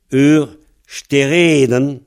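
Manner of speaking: ur, stereden said slowly with stereden stressed on the long E; teacher spells the phrase out slowly; careful model phrase. Shown as a demonstration. Breton teacher models the phrase for the student, slowly. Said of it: "comme c'est l'avant-dernière syllabe".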